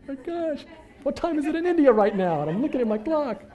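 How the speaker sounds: a laughing yelp of disbelief; exasperated panic. Retold or imitated. Waiting for the bank while thieves shop, high laughing panic.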